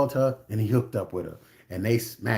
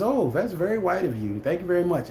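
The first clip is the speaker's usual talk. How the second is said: sarcastic thanks; 7 semitones higher than usual. Retold or imitated. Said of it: "he says".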